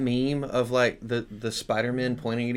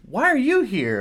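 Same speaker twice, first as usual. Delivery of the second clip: high, louder accusing question; mock accusation; 11 semitones higher, 4 dB louder than usual. Danites challenging the Levite, high and accusing. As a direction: point the finger, Spider-Man meme style. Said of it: "these Danites come along".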